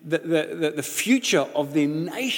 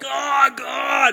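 excited exclamation; 9 semitones higher, 4 dB louder than usual. Retold or imitated. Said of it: "I'm like".

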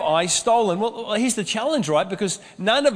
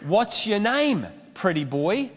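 ordinary talk, nothing marked

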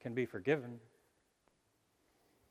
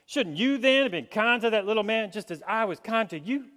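put-on Virginia accent; 3 semitones higher, 5 dB louder than usual. Preacher retells a parable to the congregation in a Virginia accent. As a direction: tell it big in a Virginia accent. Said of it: "Virginia accent".

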